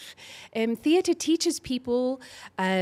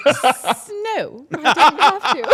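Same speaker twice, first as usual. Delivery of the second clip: louder, laughing refusal; 7 dB louder than usual. Real, not acted. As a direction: laugh the words out. Laughing off the request.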